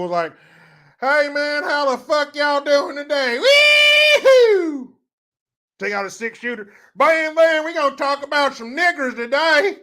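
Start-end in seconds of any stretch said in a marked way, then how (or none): 1.0-5.0 high, whooping hammed-up good old boy. 6.9-9.8 loud, high-pitched hammed-up rabble-rouser.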